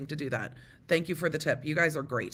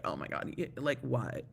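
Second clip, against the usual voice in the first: much lower, quieter stunned mock voice; deep mock juror voice; 3 semitones lower, 6 dB quieter than usual.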